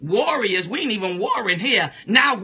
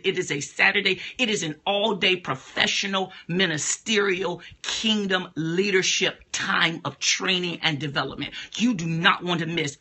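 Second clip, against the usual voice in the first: normal talking voice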